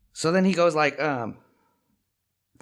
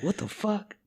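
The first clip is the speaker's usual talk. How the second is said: put-on bewildered kid; 9 semitones higher, 5 dB quieter, slower than usual. Timmy finds more dog shit.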